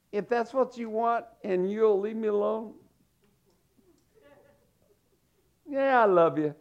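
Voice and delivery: high, put-on sweet compliance; acting agreeable. Child acting for mama to be left alone, feigned sweetness. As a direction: play the obedient kid who doesn't mean it. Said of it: "You learn to be an actor".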